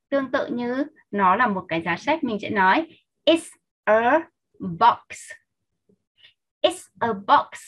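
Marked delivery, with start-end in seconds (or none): 3.2-5.3 slow, with a gap after each word; careful, spaced-out teacher's demonstration; word-by-word model. Shown as a demonstration. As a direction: separate IT'S, A and BOX for learners.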